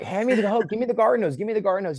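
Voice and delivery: high, loud urgent yelling; frantic shout. Retold or imitated. Man on burning roof yelling down for the garden hose, frantic shout. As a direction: demand the hose in a panic. Demanding the hose during fire.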